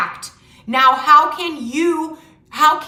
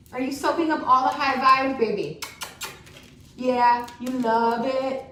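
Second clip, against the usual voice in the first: pet talk. Real, not acted. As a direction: coo to the pet as an aside. Talking to her pet beside her.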